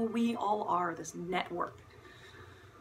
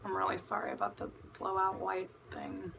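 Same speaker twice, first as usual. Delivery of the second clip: whispered apology; 7 dB quieter than usual. Real, not acted. Quick apology about lighting.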